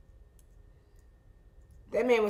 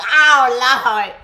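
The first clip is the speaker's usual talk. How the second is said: loud mock wail; 4 semitones higher, 11 dB louder than usual. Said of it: "I was like".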